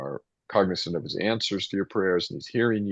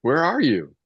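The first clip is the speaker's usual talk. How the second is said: raised, pleading call upward; anguished cry to God; 7 semitones higher, 5 dB louder than usual. Acted. Preacher voicing Job's cry to God, anguished raised call. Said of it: "And I've been crying out to you".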